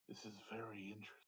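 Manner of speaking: fast, hushed wizard murmur; quick whisper. Acted. Wizard eyes the sword, whispering quickly to himself. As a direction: rush it under your breath, fascinated.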